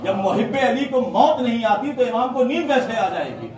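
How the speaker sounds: high, loud, rising sermon climax; impassioned majlis preaching. Acted. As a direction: preach it out to the crowd with fervour. Majlis preaching at full force. (پرجوش مجلس کا خطاب)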